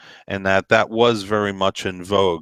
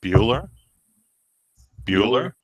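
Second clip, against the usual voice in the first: deadpan roll call. Acted.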